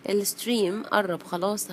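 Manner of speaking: plain reading voice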